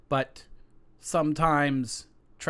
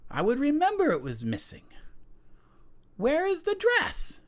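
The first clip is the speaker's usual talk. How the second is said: haughty queen; 13 semitones higher than usual. Acted. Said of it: "And the queen says".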